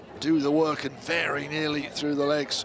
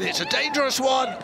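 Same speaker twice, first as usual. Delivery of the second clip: excited shout; 6 semitones higher than usual. Real, not acted. Dangerous ball into box.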